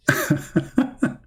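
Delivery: short, high burst of laughter; real chuckle. Real, not acted. Speaker still amused after saying anyway, real short laugh. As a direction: let a leftover laugh burst out.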